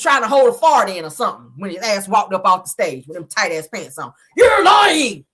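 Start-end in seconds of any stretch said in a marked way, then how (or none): 4.4-5.2 high, loud mimicked shout; hollering. Martell walking off the stage at Mel, hollering. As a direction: holler it long and high, mocking him. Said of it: "hollering at Mel".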